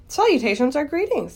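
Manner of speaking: high, explaining little voice; lightly voiced small creature; thin voice. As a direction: pipe up to explain kindly. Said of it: "he heard again the thin voice".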